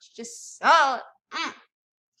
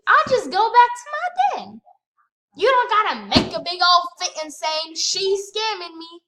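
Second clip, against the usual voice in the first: sassy mock; 4 semitones higher, 7 dB louder than usual. Real, not acted. Mocking the other player.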